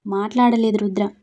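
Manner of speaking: plain reading voice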